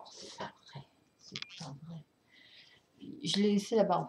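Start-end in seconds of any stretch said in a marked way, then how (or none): 0.1-2.0 real frustrated whisper, almost inaudible; muttering under her breath in annoyance; whispered to herself. Real, not acted. Fan who forgot the record, whispering to herself in annoyance.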